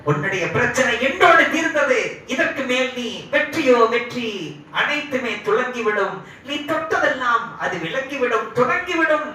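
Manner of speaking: high, ringing promise; triumphant assurance. Retold or imitated. Angel promising the weeper success, high and triumphant. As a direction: proclaim victory with mounting fervour. Angel proclaims end of troubles.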